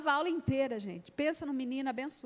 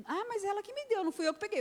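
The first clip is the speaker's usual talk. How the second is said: put-on high, whiny child protest; a daughter insisting a friend gave her the money; protesting little girl; 9 semitones higher, faster than usual. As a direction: plead innocence like a small girl.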